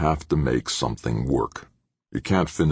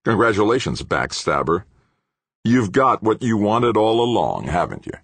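higher, bitterly sarcastic; bitter accusation; 8 semitones higher, 4 dB louder than usual. A narrator's reading. Fired engineer turning on his colleague, bitterly accusing. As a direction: spit resentment at a betrayer.

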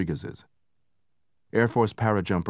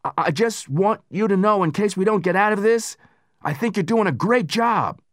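high, loud, pleading comrade; emotional soldier voice; 14 semitones higher, 5 dB louder than usual. Acted. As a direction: say it like it might be goodbye. Narrator voices Hurd's farewell.

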